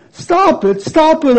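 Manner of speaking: high pleading cry. A narrator's reading. Teacup begging its master to stop the fumes. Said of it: "I cried".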